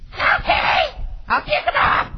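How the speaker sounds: put-on high, exasperated child's holler; mock bratty yell. Child snapping at parent over toys, bratty yell. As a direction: yell back like a put-upon kid. Child's angry reply, acted.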